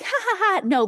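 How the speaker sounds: giggly high no with a laugh. Shown as a demonstration. Teacher demos a joking no to class. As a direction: laugh it off while saying no. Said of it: "If you're like".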